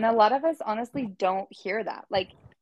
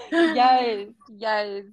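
laughing yes; 5 dB louder than usual. Real, not acted. Laughing agreement.

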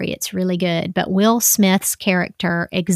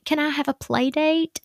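put-on child voice; 8 semitones higher, 4 dB quieter than usual. Daughter asking for play date.

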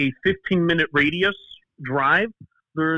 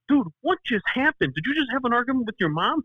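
higher-pitched incredulous questioning; quick, mock-alarmed friend; imitating shocked friend; 8 semitones higher, faster than usual. Friend asking after witnessing mom's scolding, imitated shock.

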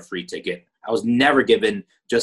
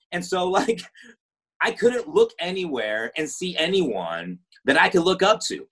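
ordinary talk, nothing marked

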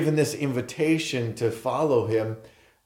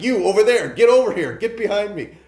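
calling out; 8 semitones higher, 7 dB louder, faster than usual. Retold or imitated. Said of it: "It's like".

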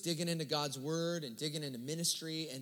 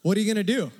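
much louder, stunned questioning; loud shocked reply; 3 semitones higher, 11 dB louder, faster than usual. Retold or imitated. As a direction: blurt it out in disbelief. Shocked reply to friend's news.

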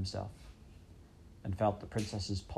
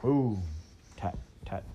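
drum beat; slower than usual. A narrator's reading. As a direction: sound out a distant council drum. Drum echoing through the castle.

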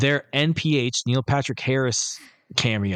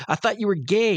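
mock outraged woman; 9 semitones higher than usual. Woman voiced in retold scene.

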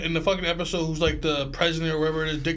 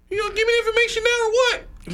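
squeaky high puppet voice. Retold or imitated. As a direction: play it like a wooden puppet talking. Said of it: "you're doing a puppet's voice".

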